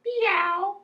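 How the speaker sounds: high, loud imitation meow; drawn-out high-pitched mew; cat meow. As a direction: mimic a cat, long and high. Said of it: "My cat goes meow".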